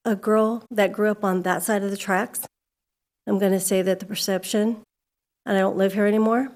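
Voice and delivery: ordinary delivery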